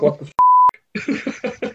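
high, very slow, drawn-out what the f-; hammed-up drawn-out shock; mock shock. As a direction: stretch it in mock disbelief. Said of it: "if the guy is like".

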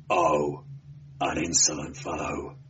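low-pitched sneer. A narrator's reading. Police prefect mocks the defiant barbarian, sneering. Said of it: "sneered Demetrio's companion".

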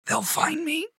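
high, strained voice of a beaten prisoner; weak prisoner pleading from the floor; dying beaten man. Acted. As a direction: force the words out through split lips. Beaten man speaks through bloodied lips.

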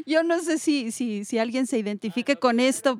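ordinary delivery

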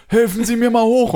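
high-pitched mock yell; imitated bellowing demand. Homeless man repeats demand to passer-by, imitated yelling. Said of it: "Und brüllt mich halt so, so schon so laut an".

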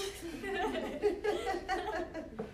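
a high, airy laugh. Real, not acted. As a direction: laugh it off lightly. Laughing after troll reply.

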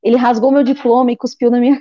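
ordinary delivery

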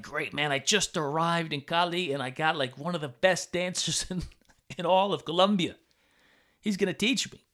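retold excitement. New student thrilled at landing a great dance teacher, excited. Retells his delight at finding teacher.

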